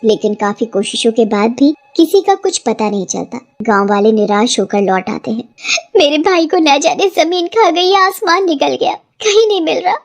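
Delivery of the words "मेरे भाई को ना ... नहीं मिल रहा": high, pleading cry of despair; desperate sister. Acted. Sister searching for her lost brother, despairing. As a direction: beg the sky for an answer.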